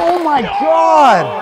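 slow, drawn-out, loud and high; stunned exclamation. Real, not acted. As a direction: stretch it out in disbelief. Reacting to a landed trick.

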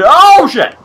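sudden loud, high yelp; real alarm. Real, not acted. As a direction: cry out as danger hits mid-sentence. Sudden alarm during the game.

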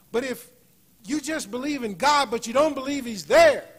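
loud, high preaching burst; preacher raising his voice over the congregation; fiery preaching. Acted. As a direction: drive the warning home, loud and high. Preaching at full force.

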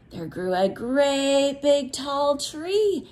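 ordinary talk

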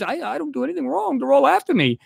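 high-pitched imitation of an innocent denial; mock protesting. Vlogger mimics rival claiming he's the victim, mocking.

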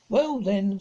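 put-on boy crowing over his sister, triumphant. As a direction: crow it as if he has won. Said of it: "said Peter triumphantly".

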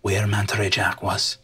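soft, low, partly whispered; hushed reading. Acted. Captain quietly reads the letter to the stowaways. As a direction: read it in a hushed secret voice.

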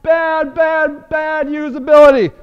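fed-up repeated bad; exasperated. Real, not acted. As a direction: hammer each bad in frustration. Venting about site.

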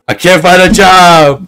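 loud, high, drawn-out wail; mock despairing shout. Rejected applicant wailing about work, mock despairing shout. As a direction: cry it out in comic despair.

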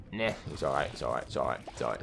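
low, soft repeated reassurance; subdued calming of yourself; self-reassurance. Real, not acted. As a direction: talk yourself down, dropping low and quiet. Reassuring himself after a mishap.

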